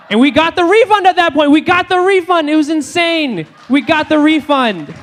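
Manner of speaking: high, loud victory yell; triumphant. Retold or imitated. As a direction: shout it like you just won. Triumphant climax of the story.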